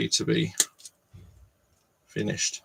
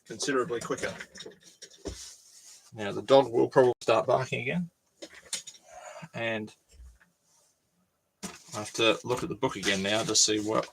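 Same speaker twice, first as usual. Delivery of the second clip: ordinary talk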